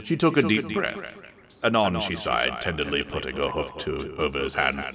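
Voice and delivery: plain reading voice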